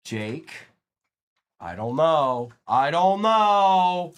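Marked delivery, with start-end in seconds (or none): 2.7-4.1 loud, very slow, stretched-out words; exaggerated drawl. Streamer repeating himself to the viewers, exaggerated drawl. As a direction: drag out the last word loudly.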